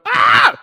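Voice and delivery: sudden loud yell of fright; hammed-up scream of shock; mock startled scream. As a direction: burst out with a short, loud shriek. Demon scares over-confident disciples.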